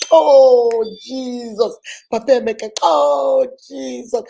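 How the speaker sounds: loud, drawn-out mock wail; big exclamations that fall away; melodramatic outburst. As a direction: cry out in hammed-up outrage. Acts accusing wife's melodramatic outburst.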